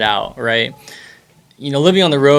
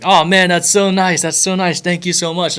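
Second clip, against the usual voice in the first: gushing thanks; 6 semitones higher, 3 dB louder than usual. Retold or imitated. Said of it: "I was like".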